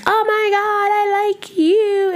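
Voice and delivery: high, slow swooning voice; mock lovestruck gush; gushing new crush. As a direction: swoon over someone you just met. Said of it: "you guys are just like".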